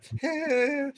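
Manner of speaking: high, quick imitation of a friend's laugh; Jeff Richards laugh. Comic imitating Jeff Richards' laugh, pitched high. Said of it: "Now I'm doing the Jeff Richards laugh".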